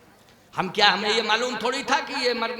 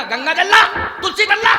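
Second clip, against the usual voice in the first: loud, high mock-urgent orders; urgent command; 8 semitones higher, 9 dB louder than usual. Preacher retells ordering family at deathbed, urgent high shouts. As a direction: call it out, pitch jumping up.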